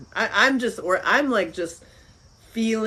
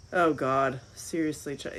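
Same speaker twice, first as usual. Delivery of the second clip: ordinary talk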